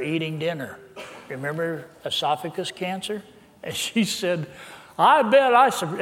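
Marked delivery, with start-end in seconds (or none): 5.0-6.0 put-on high, loud southern accent. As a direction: mimic her southern accent with raised pitch. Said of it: "in a southern accent".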